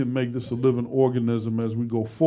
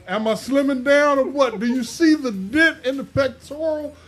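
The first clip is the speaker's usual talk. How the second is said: much higher, louder and playful; excited bragging; 12 semitones higher, 5 dB louder than usual. Real, not acted. Host showing viewers his slimmer chest, excited. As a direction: show off with gleeful pride.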